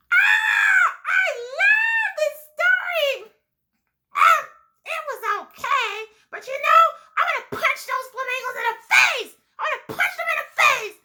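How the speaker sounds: giggly puppet yelling about the story; Libby puppet voice. Acted. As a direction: shout it out like an excited little puppet.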